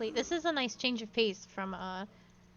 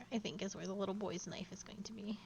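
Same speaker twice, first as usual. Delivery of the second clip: whispering; 3 semitones lower, 8 dB quieter, faster than usual. Real, not acted. Whispered aside while playing.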